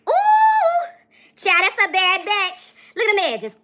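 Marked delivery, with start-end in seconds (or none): 0.1-0.9 very high loud ooh; squealing ooh. Real, not acted. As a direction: squeal it like a shocked friend. Exclaiming over edges.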